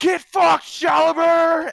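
full-force high-pitched heckle yelled across a crowd; Bobby screaming. Retold or imitated. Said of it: "You hear Bobby screaming at the crowd".

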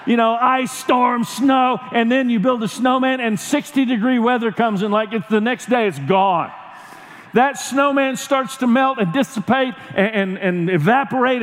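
ordinary delivery

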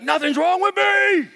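high-pitched, hammed-up freak-out; acted-out frantic protest, voice shooting up. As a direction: squeal a frantic denial. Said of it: "freaked out".

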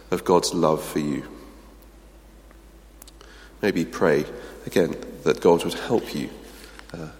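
guided pause before prayer; quiet reflection. Acted. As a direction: invite them gently into stillness.

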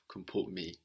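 ordinary talk, nothing marked

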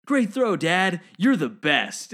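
high, loud, gushing praise; acted proud son. Buzz playing catch with Dad, loud gleeful praise. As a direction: cheer like a delighted kid. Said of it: "He's like".

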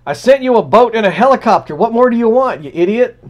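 high, loud mock scolding; hammed-up annoyed deity; exasperated God. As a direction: scold the drowned man.

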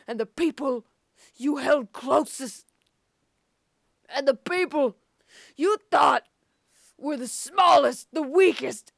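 rising from level to loud; building to a shout. Acted. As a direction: build the speech to a shouted climax.

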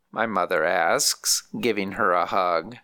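ordinary delivery

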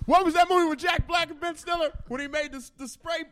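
excited. Real, not acted. Excited recalling movie.

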